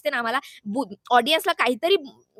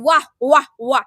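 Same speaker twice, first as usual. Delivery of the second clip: sarcastic applause; 7 dB louder than usual. Real, not acted. Sarcastic applause at double standard.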